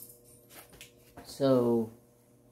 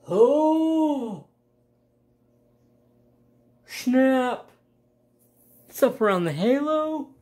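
loud, high exclamations; thrilled, high-pitched surprise; excited discovery; 9 semitones higher, 7 dB louder, slower than usual. Real, not acted. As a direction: burst out high and loud as it dawns on you. Discovers where the color goes.

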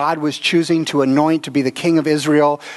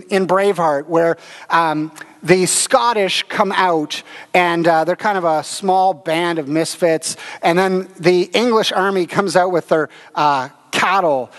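ordinary talk

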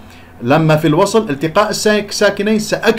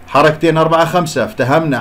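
normal talking voice

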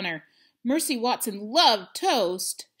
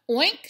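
high, loud oink; a startled little grunt; pig oink; 7 semitones higher, 6 dB louder than usual. A narrator's reading. Pig wakes up.

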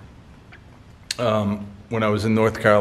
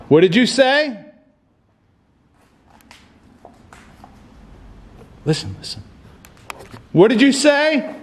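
much higher, urgent call into the dark; calling out to a spirit; 11 semitones higher than usual. Real, not acted. Ghost hunter calling out to a voice he heard, high and urgent. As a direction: demand an answer from the unseen.

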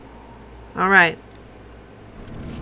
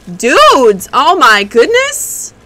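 excited shout; 3 semitones higher, 7 dB louder, much slower than usual. Real, not acted. Excited about boss move.

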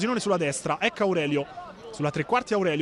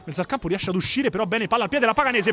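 ordinary delivery

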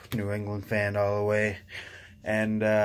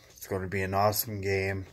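ordinary delivery

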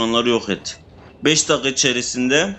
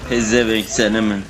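ordinary delivery

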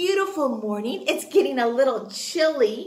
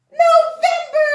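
much higher and louder cry; excited answer; 9 dB louder than usual. Acted. Teacher cheering the class answer, high and loud. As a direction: call it out like a prize answer.

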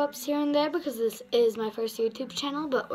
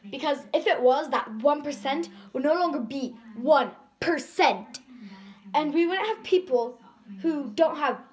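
bold spoken-word delivery, a touch louder; slam poetry recitation; 2 semitones higher, 4 dB louder than usual. Acted. Girl performing her slam poem for viewers, earnest.

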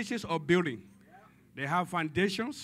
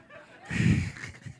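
breathy laugh; 5 dB louder than usual. Real, not acted. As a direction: chuckle at the silly memory. Laughing at own flower story.

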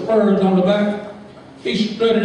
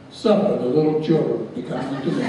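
a lightly voiced solemn preacher, lower and softer; mock pious; 5 semitones lower, 3 dB quieter than usual. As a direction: intone it like a pious deacon. [name] piously quotes scripture.

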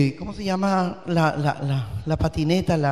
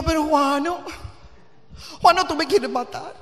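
alarmed mother; 7 semitones higher, 3 dB quieter than usual. Retold or imitated.

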